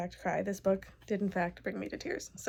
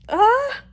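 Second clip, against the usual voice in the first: 1 delighted gasp; 13 semitones higher, 12 dB louder than usual. Real, not acted. Gushing over the book.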